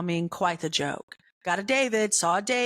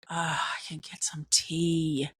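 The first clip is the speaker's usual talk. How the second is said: soft unvoiced whisper to herself; whispered thought; 3 semitones lower, 3 dB quieter than usual. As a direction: whisper it like a private hope. Thinking to herself in line.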